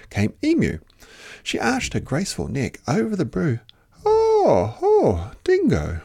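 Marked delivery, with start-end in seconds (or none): 4.0-6.0 high, fluttery lady's voice; fluttery Emu. Acted. Emu flutters over the brew at Dingo. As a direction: flutter like a vain bird. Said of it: "she fluttered".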